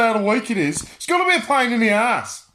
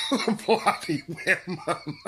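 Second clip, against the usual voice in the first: can't keep a straight face, words breaking into laughter; cracking up mid-sentence, slow and giggly; laughing; slower than usual. Real, not acted. Vlogger cracking up at his own joke about women, laughing. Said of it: "I can't keep a straight face".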